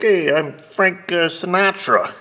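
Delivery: loud, high, slow mock-Italian voice; hammed-up Italian guy introducing himself. Mechanic imitates an Italian customer's introduction, hammed-up Italian voice. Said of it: "Not that he talked like that".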